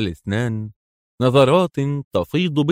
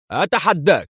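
higher-pitched firm challenge; resolute; 7 semitones higher than usual. A narrator's reading. General dares the agent, resolute exclamation. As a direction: throw down the challenge. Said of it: "هتف ياكوف في حزم".

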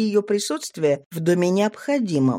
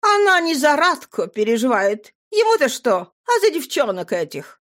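put-on higher, earnest young girl; 6 semitones higher than usual. A narrator's reading. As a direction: speak up as a girl. Nadira voiced.

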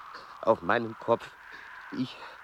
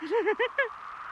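high giddy laugh. Acted. Altitude-dizzy laughter.

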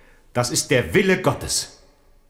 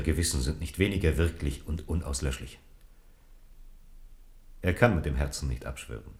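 grave and hushed; 8 semitones lower, 8 dB quieter than usual. Acted. Mornay's grave quiet plea.

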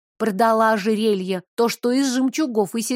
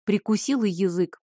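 ordinary delivery, nothing marked

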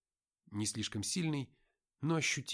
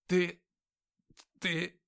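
high faltering cry, searching for words; stammering; 7 semitones higher, 7 dB louder than usual. Acted. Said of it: "Она пытается подобрать слова".

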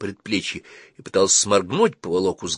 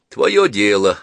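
dismissive; 7 dB louder, slower than usual. Acted. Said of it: "отмахнулся Калик".

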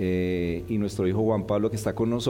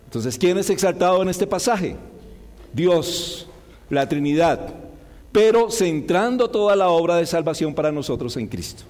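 fervent preaching; 5 semitones higher than usual. Acted. Preacher exhorts the congregation.

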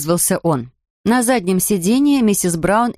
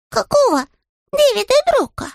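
high puzzled bear voice; surprised. Acted. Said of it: "удивился Паддингтон".

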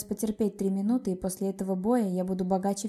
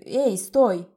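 sharp loud call to stop; shouting; 9 dB louder than usual. A narrator's reading. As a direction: shout after him to stop. Sammy calls out to Kosta.

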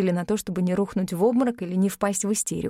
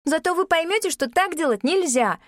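put-on higher, pouting young girl; sulky girl; 8 semitones higher than usual. A narrator's reading. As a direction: scold like a sulky child. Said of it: "надулась Алекса".

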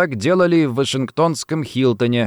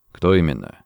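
low, controlled question; terse question; 8 semitones lower than usual. A narrator's reading. Saunders presses gun to Rossetti's temple, terse. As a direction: ask «Кто именно» briefly in a dropped voice. First interrogation question, then repeated.

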